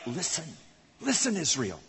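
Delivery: low, hushed words of God to Moses; soft voice of God. Retold or imitated. God on the mountain telling Moses the words in a hushed voice. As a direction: say it softly and gravely. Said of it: "And then he says".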